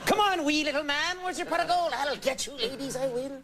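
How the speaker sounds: high Irish leprechaun voice. Pretend. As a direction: impish little leprechaun. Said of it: "they're like".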